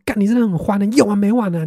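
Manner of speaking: exasperated cursing, half re-enacted; angry outburst. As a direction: snap back in mock fury at a stubborn sibling. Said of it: "气到我真的是开口闭口说".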